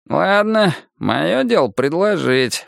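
put-on drawn-out grumble from an old seller; grumbling old man. A narrator's reading. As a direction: grumble it slowly, as if shrugging off the deal. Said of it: "проворчал он". (старческое ворчание, протяжно)

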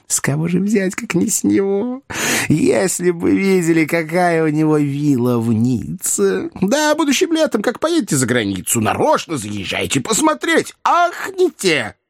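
high, put-on rapturous chatter; gushing wheedling fixer. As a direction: gush in a high character voice, stretching the climax. Voices the fixer gushing to chairman. (суетливый ходатай, восторженно и высоко)

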